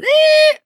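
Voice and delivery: high, loud imitated brake squeal; squeaking brakes. Storyteller mimics his bad brakes, high squeal. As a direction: screech like failing brakes. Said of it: "The squeaking".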